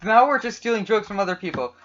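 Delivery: usual speaking voice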